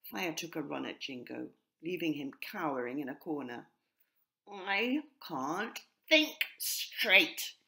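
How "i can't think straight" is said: very slow, louder jackdaw caw. A narrator's reading. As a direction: drag the words out in frustration. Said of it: "he cawed".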